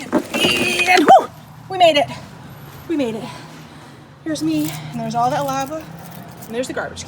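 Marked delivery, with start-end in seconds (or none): 0.0-2.1 high, loud make-believe whoop of relief; triumphant relief. Pretend. Host clearing the pretend lava, loud triumphant relief.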